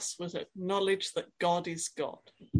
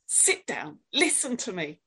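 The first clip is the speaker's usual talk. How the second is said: stern command; 4 semitones higher, 4 dB louder than usual. Acted. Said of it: "does he say it to our minds".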